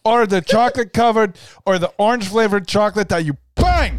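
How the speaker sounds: laughing. Real, not acted. Joking about chocolate orange.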